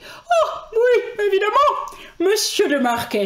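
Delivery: high, loud, drawn-out exalted judge; Monsieur de Marquet. Acted. Playing the self-important judge, hammed-up high voice. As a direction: go over the top, shrieky and pompous.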